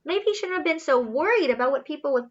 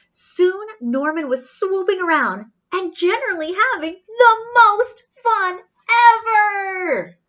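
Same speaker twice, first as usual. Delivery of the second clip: excited; 6 semitones higher, 5 dB louder than usual. Acted. Reader builds joy of flight.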